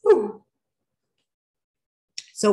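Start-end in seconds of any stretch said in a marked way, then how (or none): 0.0-0.4 loud relieved whew. Real, not acted. Reacting to the yawn.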